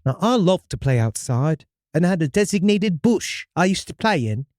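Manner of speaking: storyteller in a put-on British accent; hammy English-accented first-person tale. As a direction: read it with an amateur British accent. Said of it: "Can you stick with this accent".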